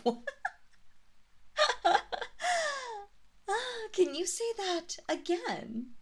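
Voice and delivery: high incredulous laugh; amused laugh. Acted. Mistress laughing at her servant's outburst. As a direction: laugh in disbelief.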